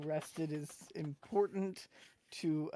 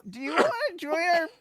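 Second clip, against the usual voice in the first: much higher, louder awkward squeak; high-pitched screech; 10 semitones higher, 6 dB louder than usual. Acted. Awkward boy asks the cook to join the party, screeching high. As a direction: squeak the invitation like a nervous boy. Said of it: "your kinda screech or your high-pitched voice".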